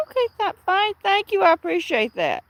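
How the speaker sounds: imitating herself being gracious; polite thanks. Thanking the sender, in a mildly high polite voice. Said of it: "I'm like".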